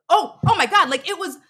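a rush of memory bursting out; sudden excitement. Real, not acted. Guest suddenly remembering the tour, excited burst.

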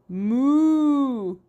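long, drawn-out moo; storybook cow sound, held long. A narrator's reading. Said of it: "said Emily".

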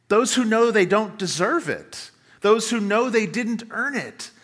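ordinary talk, nothing marked

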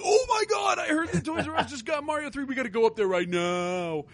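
high-pitched, hammed-up excited kid on the phone; Rusty, frantic with excitement. Buddy phoning a friend about Mario three arriving, hammed-up excitement. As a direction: mimic a breathless-with-news buddy, pitch way up then falling.

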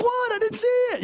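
high, innocent what-me protest; defensive protest. Retold or imitated. Husband to yelling wife, high innocent protest. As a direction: play dumb, pitch way up.

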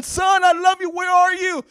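loud, high, pleading shout; father calling for his buried son; desperate shouting. Acted. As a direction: yell it into the rubble. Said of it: "calling for his son".

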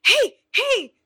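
put-on high, bright call to get a kid's attention. Teacher calling a second grader over, high call.

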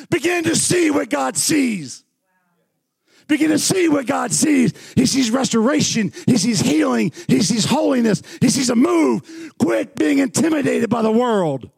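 high, ringing exhortation in punched phrases; excited preaching. Real, not acted. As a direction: urge the congregation on at full fervour. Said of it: "sometimes I get excited".

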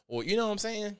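ordinary talk, nothing marked

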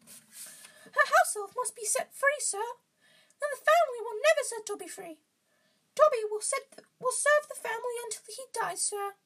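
very high, loud squeaky elf; Dobby. A narrator's reading. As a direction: answer in a squeaky falsetto. Reader acting Dobby's answer.

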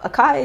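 acai said uh-KAI; hard k in the middle of acai; crunchy pronunciation. Shown as a demonstration. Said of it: "That's crunchy to me".

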